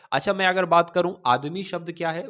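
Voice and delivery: usual speaking voice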